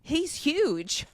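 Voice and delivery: high gushing quote; imitating people. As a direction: play the amazed onlookers. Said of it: "they're like".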